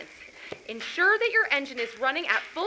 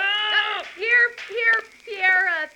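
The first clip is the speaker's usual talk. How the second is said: startled and loud; 7 semitones higher, 6 dB louder, slower than usual. Acted. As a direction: caught off guard, flustered. Startled pilot answering Pierre.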